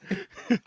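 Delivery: brief high chuckle; little burst of laughter; short laugh. Real, not acted. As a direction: let out a quick amused giggle. Real laugh after retold line.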